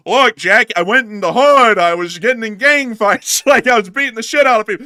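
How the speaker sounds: loud, high put-on voice of a bragging politician; mock politician voice. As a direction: ham up a tough-guy boast, loud and high. Mocking a politician's rags story.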